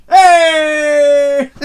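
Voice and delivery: long, loud, high hail; happy greeting. Real, not acted. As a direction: holler a drawn-out welcome to a friend. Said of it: "I'm so happy that you're here".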